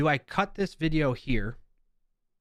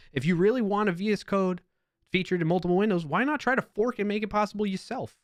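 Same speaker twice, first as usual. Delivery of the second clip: put-on New York accent; 8 semitones higher than usual. Streamer reading a blunt commenter aloud in a New York accent. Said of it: "I don't know why I added my New York accent in there".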